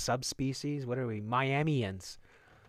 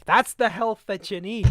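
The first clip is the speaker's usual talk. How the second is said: sarcastic pitch; 9 semitones higher, 6 dB louder than usual. Acted. Mocking telehealth.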